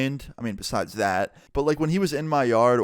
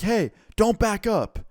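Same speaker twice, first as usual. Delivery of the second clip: higher, drawn-out warning call; urgent call; 8 semitones higher, slower than usual. Retold or imitated. Storyteller warns driver about the dog, urgent call. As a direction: call out to stop someone, pitched up and slow.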